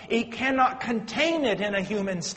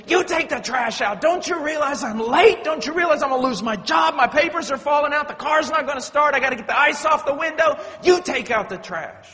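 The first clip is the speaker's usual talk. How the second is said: angry outburst; 5 semitones higher, 5 dB louder than usual. Retold or imitated. Husband snaps at nagging wife.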